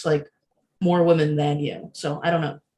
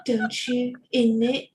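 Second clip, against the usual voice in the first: put-on high, playful proper British accent; mock British dirty talk with tag questions; 5 semitones higher than usual. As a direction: imitate a proper British boyfriend.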